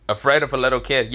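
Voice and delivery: quick, incredulous echo in a natural pitch; Konohamaru. Acted. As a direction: throw the question back fast, scoffing. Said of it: "Konohamaru says".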